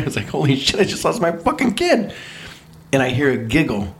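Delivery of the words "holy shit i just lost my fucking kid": high, panicked inner cry of a terrified dad; retold panic. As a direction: relive the jolt of losing your kid, much higher. Retold panic over lost child.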